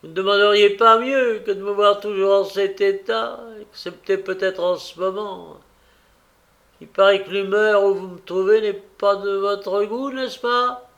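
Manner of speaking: acted embittered jibe; bitterness. A narrator's reading. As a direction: needle them bitterly, pitch lifting. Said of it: "Dit-elle avec amertume".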